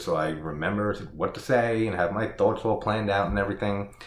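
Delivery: ordinary talk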